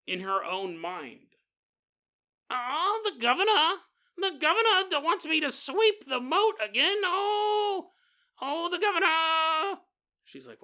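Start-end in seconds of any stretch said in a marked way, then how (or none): 2.5-9.8 hammed-up fake British accent, much higher and louder; moaning servant in a terrible British accent; a downtrodden castle servant complaining in a terrible fake British accent; worst British accent. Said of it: "the worst accent possible".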